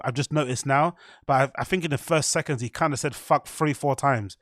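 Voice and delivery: ordinary delivery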